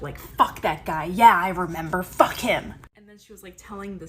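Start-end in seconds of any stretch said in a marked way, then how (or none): 0.2-2.7 loud, emphatic mock egging-on; hyping her up. Mom hyping up the aunt as she tells of being cheated on, loud imitated egging-on. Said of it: "my mom was all hyping her up".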